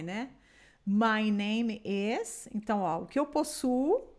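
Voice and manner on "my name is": My name is very slow with rising end; slow model phrase. Shown as a demonstration. Teacher illustrating possession with slow example. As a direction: draw it out for learners. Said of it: "é o meu nome".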